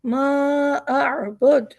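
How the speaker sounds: मा अअबुद with clearly separated अ and अ; clear alif and ain. Shown as a demonstration. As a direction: keep अलिफ and आइन distinct in अअबुद. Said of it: "अलिफ और आइन के दरमियान वाज़े फ़र्क होना चाहिए". (अलिफ और आइन में साफ़ फ़र्क)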